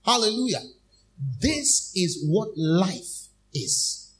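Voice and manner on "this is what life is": very slow, weighty declaration; slow climax. Acted. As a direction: stretch each word with conviction.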